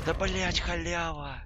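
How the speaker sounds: high triumphant shout; excited yell. Real, not acted. Triumphant yell after a win.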